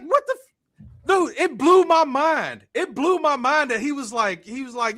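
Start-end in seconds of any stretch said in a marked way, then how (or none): 0.0-0.9 very high, stunned cry; shocked disbelief. Retold or imitated. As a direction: gasp it out in utter disbelief. Stunned reaction to insult.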